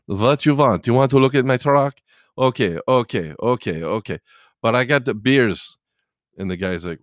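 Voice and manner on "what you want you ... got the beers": put-on loud, higher Russian-accented guy; imitated Russian accent, pitched up. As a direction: play an insistent Russian trucker with a thick accent. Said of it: "he's a Russian guy".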